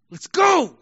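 high, sharp yell; insistent shout. Acted. Some in Jerusalem a week later, shouting. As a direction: shout it out hard. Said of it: "some would say".

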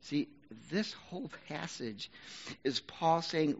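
normal talking voice

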